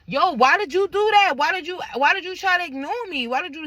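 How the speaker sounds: high, loud and insistent; retold angry confrontation. Woman confronting boyfriend who ignored her, retold angry confrontation. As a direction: fire the question back, pitched well up. Retells her heated argument back.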